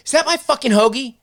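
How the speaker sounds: high, loud accusing demand; imitating Deco's suspicious snap; angry accusation. Deco accuses his friend of eating his hoagie, angry.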